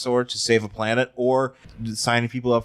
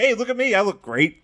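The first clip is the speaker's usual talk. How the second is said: lightly voiced show-off creature, pitched up; hammed-up, higher self-admiring boast; proud dinosaur; 8 semitones higher than usual. Host plays transformed dinosaur showing off, mock-proud and higher.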